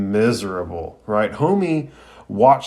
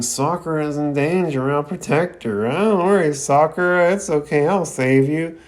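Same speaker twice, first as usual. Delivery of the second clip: high-pitched mock sleep-talking; put-on higher voice, talking while unconscious; Naruto; 6 semitones higher than usual. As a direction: imitate someone babbling protectively in their sleep. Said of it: "still unconscious and sleep talking".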